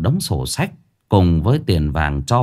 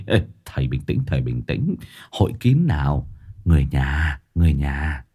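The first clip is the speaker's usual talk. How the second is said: lower, softer soothing voice; calming; 6 semitones lower, 4 dB quieter than usual. A narrator's reading. Trình calming his panicked brother, softer and lower. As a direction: hush him and calm him down.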